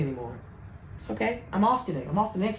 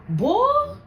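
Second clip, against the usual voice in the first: emphatic exclamation; 13 semitones higher, 3 dB louder than usual. Real, not acted.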